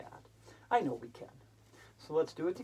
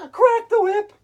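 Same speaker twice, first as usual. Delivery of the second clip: much higher, louder cry; poem's peak yelled out; shouted line; 19 dB louder, slower than usual. Acted. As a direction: crack it out loud. Poem climax shouted.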